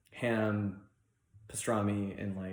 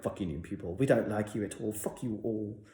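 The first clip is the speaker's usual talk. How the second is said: passive aggressive; 2 semitones lower, 4 dB quieter than usual. Pretend. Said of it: "passive aggressive way to be like".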